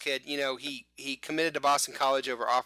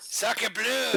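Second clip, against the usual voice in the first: higher, louder, over-the-top French exclamation; a hammed-up French accent at its peak; terrible French accent; 8 semitones higher, 5 dB louder than usual. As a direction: throw it out like a cartoon Frenchman, bad French accent. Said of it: "my terrible French accent".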